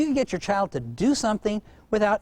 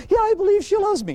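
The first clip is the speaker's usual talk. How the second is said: put-on little child's voice; 5 dB louder than usual. Child voiced in retold story.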